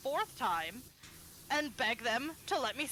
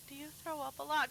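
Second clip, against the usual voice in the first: soft, raised-pitch concerned question; concerned dentist; 4 semitones higher, 6 dB quieter than usual. Retold or imitated. Dentist with a hand on the patient's shoulder, softly concerned. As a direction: imitate a dentist gently prying. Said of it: "put their hand on my shoulder".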